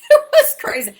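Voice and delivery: laughs it out, loud and high; laughing. Real, not acted. Telling viewers about her manic thinking, laughing hard. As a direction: bursts into a big laugh mid-line.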